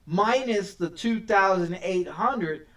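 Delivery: ordinary talk, nothing marked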